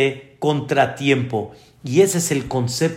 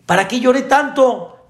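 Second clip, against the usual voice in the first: put-on much higher, louder child's outraged whine; much higher outraged child wailing at mom; indignant child; 9 semitones higher, 4 dB louder than usual. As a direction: wail it like a kid who feels cheated. Child complains in joke story.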